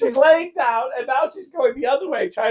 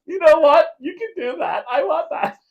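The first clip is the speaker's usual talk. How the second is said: laughing high; 8 semitones higher than usual. Real, not acted. Agreeing about sunglasses for the lamp, laughing. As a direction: laugh it out excitedly.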